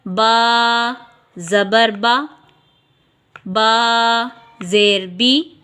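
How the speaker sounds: बा ज़बर बा and बा ज़ेर बी spelled slowly and evenly; patient spelling drill; slow drilled reading. Shown as a demonstration. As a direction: read without stretching or jerking. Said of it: "अब हम कैसे पढ़ेंगे?".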